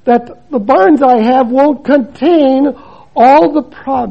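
loud, slow, emphatic Bible reading; booming scripture reading; preaching the parable. Acted. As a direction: proclaim the parable from the pulpit. Reads the parable at full force.

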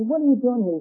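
acted-out scolding, pitched high; indignant retold challenge. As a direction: confront him as the cross conductor. Acted quarrel, conductor finds the boy.

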